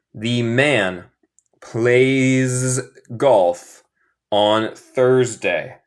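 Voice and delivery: slow, spaced-out words; slow model reading. Shown as a demonstration. Teacher models the sentence for students, slow model reading. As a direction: read it out slowly, phrase by phrase.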